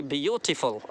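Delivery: beautiful with a long stressed "beau". Shown as a demonstration. Teacher uses it in a sentence, drawn-out stress in beautiful. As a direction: stretch the first part of beautiful. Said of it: "आप इसको सुनेंगे तो कितना अच्छा लग रहा है".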